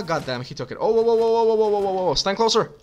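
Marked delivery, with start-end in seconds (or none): 0.8-2.1 loud, panicked whoa whoa; alarmed whoas. Real, not acted. Battle suddenly goes wrong. 2.1-2.7 loud, high urgent command; urgent shout. Real, not acted. Urgent order mid-battle.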